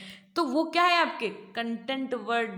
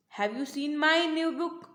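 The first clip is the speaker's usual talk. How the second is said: slow question leaning on MY; stress on MY; 2 semitones higher, much slower than usual. Shown as a demonstration. Teacher demonstrating how stress changes meaning, stress on MY. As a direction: draw out and punch the word my. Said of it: "repeated with different words".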